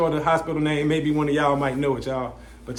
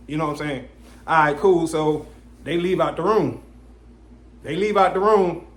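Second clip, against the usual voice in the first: ordinary talk, nothing marked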